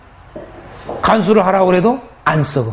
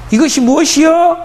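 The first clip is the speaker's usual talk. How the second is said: puzzled asking; 7 semitones higher, 3 dB louder than usual. Retold or imitated. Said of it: "누구냐 그러잖아요".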